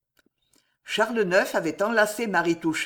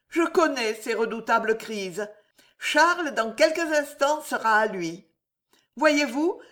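grateful mistress; 5 semitones higher than usual. Acted. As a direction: thank her with tender relief. Marie thanks Jeanne.

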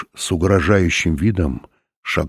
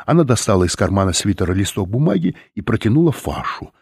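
plain reading voice

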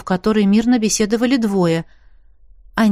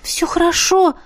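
flustered, pitched-up reassurance; a shy, awkward high "хорошо"; embarrassed; 10 semitones higher than usual. Acted. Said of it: "смутилась девушка".

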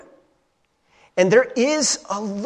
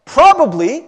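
ordinary delivery

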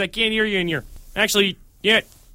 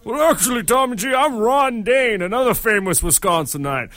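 Ron Dane; 3 semitones higher than usual. Acted. Guest introduces himself to the host, high old-man voice. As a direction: creak along like a seventy-five-year-old. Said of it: "sound like a seventy-five-year-old man".

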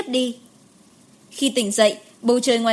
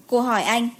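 ordinary delivery

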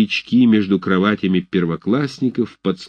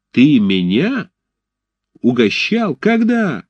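incredulous; 6 semitones higher, 3 dB louder, slower than usual. A narrator's reading. Arap indignantly questions.

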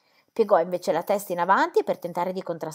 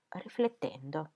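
ordinary delivery

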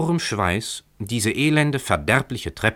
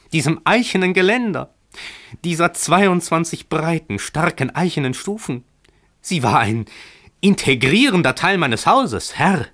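higher and louder, rising indignation; angry landlord; 8 semitones higher, 3 dB louder than usual. A narrator's reading. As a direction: scold the tenant for his lost staircase. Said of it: "knirschte Emmerich".

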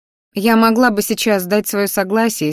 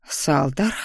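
low, soft, wary call of a name; hushed, worried questioning; uneasy; 5 semitones lower, 5 dB quieter than usual. A narrator's reading. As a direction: say the name with quiet apprehension. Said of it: "обеспокоенно заёрзала я в кресле".

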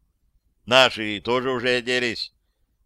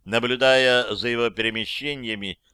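usual speaking voice

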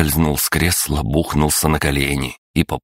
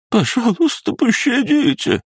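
very high, desperate plea for mercy; begging shriek. A narrator's reading.

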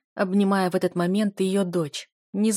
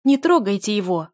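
loud plea to stop; protective cry; 2 semitones higher, 6 dB louder than usual. A narrator's reading. Дель to guards seizing her beloved, loud protest.

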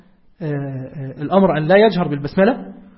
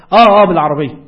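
"آه، آه، بالعربية" called out loudly as into a phone; 7 dB louder than usual. Shown as a demonstration. Teacher acting a phone call for students, loud. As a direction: answer a caller on a bad line. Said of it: "يعني أنا ماسك تليفون".

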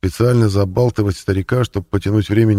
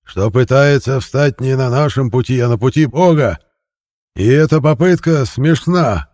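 loud, higher fervent preaching; voice soaring; 6 semitones higher, 4 dB louder than usual. A narrator's reading. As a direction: fling it up to the roof. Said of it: "Голос проповедника взлетел под крышу".

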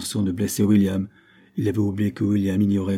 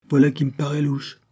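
higher-pitched, lightly raised cry; exclamation; 6 semitones higher, 4 dB louder than usual. A narrator's reading. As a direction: call it out with mild force. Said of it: "cria-t-il". (s'écrier, sans vraiment crier)

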